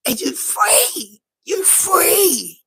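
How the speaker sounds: slow, choked-up you free; imitating a freed man near tears; mock tearful. The freed prisoner overcome with emotion, choked-up.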